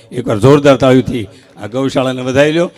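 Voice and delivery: announcer asking for a big hand for the cowshed; crowd hype. Real, not acted. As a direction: whip the crowd into clapping. Calling for applause for cowshed.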